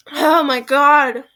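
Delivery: loud, slow, drawn-out exhale of words; exhausted relief. Real, not acted. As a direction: let it out slowly, worn out by the struggle. Relieved after the long struggle.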